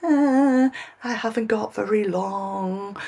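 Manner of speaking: high, drawn-out mock worry; mock panic. Vlogger voicing her worry about time running out, mock panicked. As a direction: wail it lightly, slowing as it goes. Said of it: "starting to feel a bit like".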